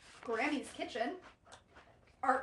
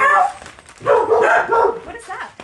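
startled; 10 semitones higher, 21 dB louder, much slower than usual. Real, not acted. Strange noise startles them.

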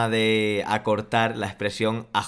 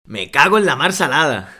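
shouted, higher and louder; loud Spanish exclamation; 5 semitones higher, 9 dB louder than usual. Shown as a demonstration. Student asked to say it like a Spaniard, shouts it loud. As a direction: belt out the curse like a real Spaniard. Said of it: "dilo como un español".

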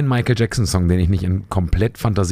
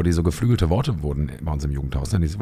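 ordinary delivery

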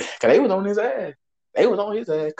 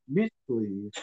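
low, softer scoff after a laugh; dismissive scoff; 7 semitones lower, 7 dB quieter than usual. Retold or imitated. Answering the woman's dare to find her, dismissive scoff. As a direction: brush it off with a giggle before it. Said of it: "I said it exactly like that".

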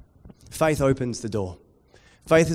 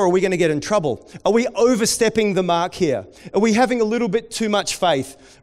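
mock worried questions; 6 semitones higher, 5 dB louder than usual. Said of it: "where they weren't sure".

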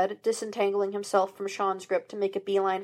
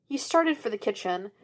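ordinary delivery

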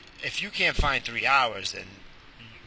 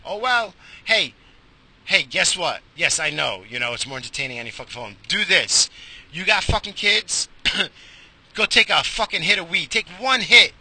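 heated rant; 5 semitones higher, 6 dB louder than usual. Real, not acted. Ranting at parents, rising.

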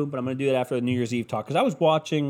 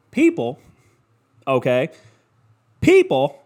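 ranting shout; 6 dB louder than usual. Real, not acted. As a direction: yell it at the crowd.

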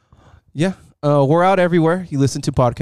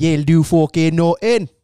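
slower Irish brogue, drawn out; Conor McGregor; 3 semitones higher, slower than usual. Retold or imitated. Repeating the Irish McGregor line slower for his pal. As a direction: lean into the Irish trash talk. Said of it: "Conor McGregor imitation".

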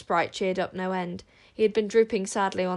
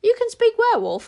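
much higher, louder astonished question; amazed; 9 dB louder than usual. Acted. Wish amazed that Tsar speaks werewolf. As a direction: marvel at it. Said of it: "deeply impressed".

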